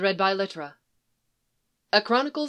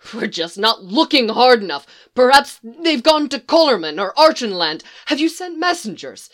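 rising, louder, urgent questions; frantic pleading; 5 semitones higher, 8 dB louder than usual. Acted. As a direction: push the search, voice climbing in panic. Tumnus frantic, pacing the room.

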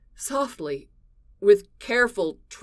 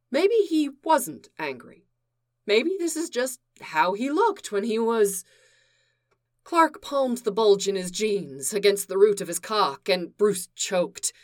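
ordinary delivery

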